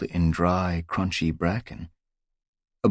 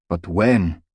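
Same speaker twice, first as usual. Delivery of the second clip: insistent; 7 dB louder than usual. Acted. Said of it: "Crag persisted".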